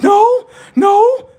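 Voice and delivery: high, loud no no; shouted protest. Acted. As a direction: yell it in protest.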